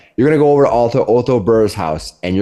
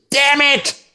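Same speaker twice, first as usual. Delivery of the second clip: a sharp, much higher yelp of a curse from behind the door; muffled curse. Acted. Man inside the house curses as things fall, high sudden yelp. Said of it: "and you hear this".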